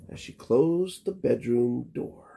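plain reading voice

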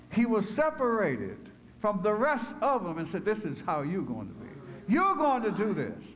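high, loud, stretched-out preaching cry; full-force preaching. Acted. Preacher exhorting the congregation at full voice.

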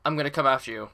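usual speaking voice

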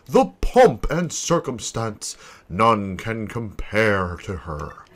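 theatrical boast, stretching out the grand phrases; grandiose ship owner. Acted. Vain owner presents his prized ship to visitors, grandiose. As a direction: show off your ship with swooping, savoured emphasis.

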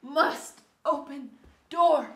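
faint dying gasps; wounded soldier gasping. Acted. Dying melodrama from smell.

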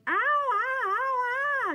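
high, loud pained ow cries; yelling in pain. A narrator's reading. Dad buried under mosquitoes, high yelling ows. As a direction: yelp as the bugs bite. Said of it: "something was yelling".

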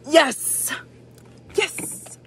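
high thrilled yelp of yes; excited cheer. Real, not acted. Vlogger thrilled her game is ready, high cheer.

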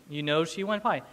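usual speaking voice

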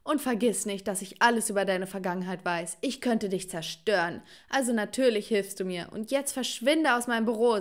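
ordinary delivery, nothing marked

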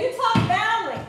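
a light mock of a playground taunt; mimicking the teasers. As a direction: echo the kids who teased her. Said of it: "when people used to say".

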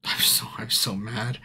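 soft, unvoiced, seething; barely audible whisper; whispered frustration. Real, not acted. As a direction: say it under your breath, defeated and angry. Frustrated streamer mutters to himself.

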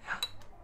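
a sharp, breathy mock gasp of delight; excited gasp. Parent playing a dice game with her child, hushed gasp. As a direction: gasp as if the roll is a big surprise.

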